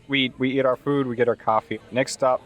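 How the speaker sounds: ordinary talk, nothing marked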